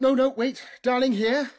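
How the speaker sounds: high, loud hurried call; urgent call. Acted. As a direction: stop her and call her back. Urgent command to robot.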